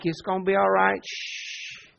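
soft comfort ending in a shushing sound; gently calm and shush; soothing hush. Acted. Parent holding a crying infant, rubbing its back, soothing.

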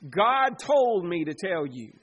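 loud, slow mock televangelist; TV preacher. Preacher imitates TV channel preachers claiming revelation, proclaiming loudly. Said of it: "if you just turn on T B N".

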